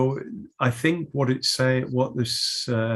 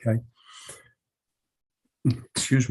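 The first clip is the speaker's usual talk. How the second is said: talking through hiccups; interrupted by hiccups; hiccuping. Real, not acted. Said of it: "my hiccups have come back with a vengeance".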